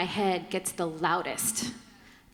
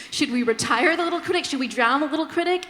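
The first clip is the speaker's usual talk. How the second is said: eager mock questions; 5 semitones higher, 7 dB louder, faster than usual. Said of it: "at one point I was like".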